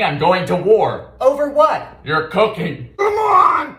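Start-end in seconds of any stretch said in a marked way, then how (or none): 1.2-3.8 hammed-up wailing shout; mock shout. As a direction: yell it in fake outrage.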